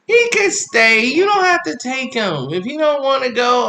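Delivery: high pleading neighbor voice; neighbor offering. Retold or imitated. Neighbor offering to keep the boy, high voice. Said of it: "the lady in two B like".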